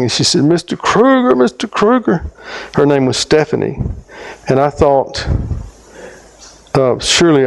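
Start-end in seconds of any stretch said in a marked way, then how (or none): 0.4-2.3 higher, mock tearful call of a girl; young woman calling. Young lady coming down the aisle, a lightly voiced tearful call. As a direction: lift your voice like a crying young woman. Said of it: "she was crying".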